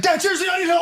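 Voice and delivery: high, loud shouted plea; mock desperate yell. Kid yelling to his dad on the phone for help, high shout. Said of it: "he's like".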